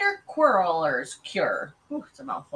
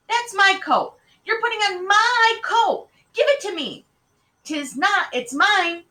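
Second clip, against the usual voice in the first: put-on high, loud childish quarrel; indignant little-girl bickering; squabbling children; 12 semitones higher, 6 dB louder than usual. A narrator's reading. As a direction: fight your sister for what is yours. Twin sisters quarrel over coat.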